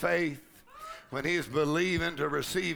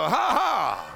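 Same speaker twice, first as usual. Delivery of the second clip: loud deliberate "ha, ha"; forceful forced laugh, falling at the end; laugh of faith; 5 semitones higher, 6 dB louder than usual. Shown as a demonstration. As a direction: demonstrate laughing on purpose. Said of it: "there is a laugh of faith".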